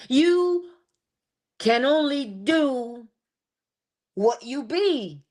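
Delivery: a loud high YOU, then slow and deliberate; slow punchy sermon line; preaching emphasis. Acted. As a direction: land each word like a verdict.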